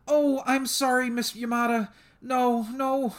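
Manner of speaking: put-on high, loud, panicky voice of a scared girl; a scared girl's high, loud pleading; frightened apology. A narrator's reading. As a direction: voice her rising fear as she apologizes.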